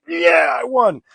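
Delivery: loud, high victory cry; hammed-up gloating shout; triumphant mother. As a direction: crow over the win as the mom.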